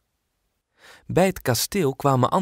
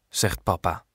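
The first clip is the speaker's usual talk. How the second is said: usual speaking voice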